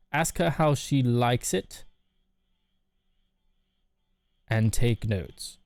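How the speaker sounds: slow, careful reading of the advice; measured, slow advice read; slow deliberate rephrase. Shown as a demonstration. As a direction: spell it out slowly and clearly. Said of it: "Let me say it without the".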